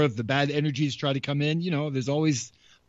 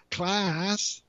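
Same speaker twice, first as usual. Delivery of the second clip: teacher voice; 5 semitones higher, 3 dB quieter than usual. Pretend. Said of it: "we're back at school again".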